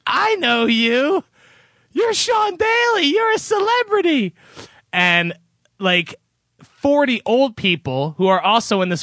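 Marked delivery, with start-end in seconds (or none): 0.0-4.4 high, drawn-out mock announcement; loud voice. Ultrasound tech recognizing him in the waiting room, loud voice. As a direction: imitate a loud, high-pitched blurting recognition. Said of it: "And in a loud vo-, voice, she says".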